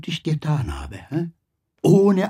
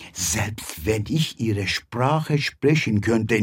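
normal talking voice

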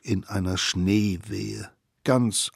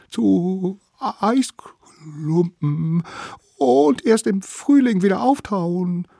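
shivering whisper; 11 semitones higher, 3 dB louder, slower than usual. A narrator's reading. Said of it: "bibberten sie".

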